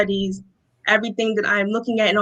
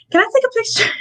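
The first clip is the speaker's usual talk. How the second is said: tiny high-pitched starstruck squeak; starstruck, frozen request; 13 semitones higher, much faster than usual. Retold or imitated. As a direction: imitate yourself asking, frozen with awe. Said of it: "I'm kinda just stuck there frozen".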